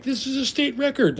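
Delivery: imitating guys thrilled at a big catch; excited. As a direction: squeal it like a thrilled fisherman. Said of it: "they're like".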